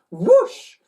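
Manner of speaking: high loud rising whoosh; high whoosh going up; whoosh sound. Acted. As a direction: whoosh swinging upward. Sound effect of flight.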